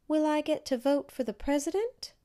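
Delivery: lightly voiced old woman, hopeful question; a hint of an old lady asking eagerly. A narrator's reading.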